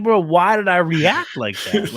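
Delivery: self-scolding disbelief. Retold or imitated. Dad scolding himself, retold.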